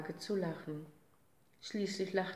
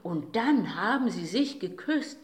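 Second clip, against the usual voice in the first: breathless excitement; 7 dB louder than usual. A narrator's reading. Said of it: "rief er am Schluss seines Berichtes, ganz atemlos".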